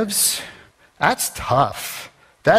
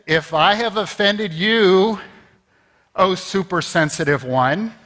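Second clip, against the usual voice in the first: mock non-apology; 5 semitones higher than usual. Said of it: "Or the famous".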